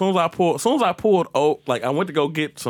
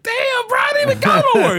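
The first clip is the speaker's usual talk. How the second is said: high-pitched, incredulous outburst, getting louder; exasperated protest; 6 dB louder than usual. Retold or imitated. Driver protests second stop to cop, high and exasperated.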